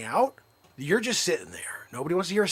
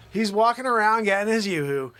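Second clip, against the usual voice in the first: ordinary delivery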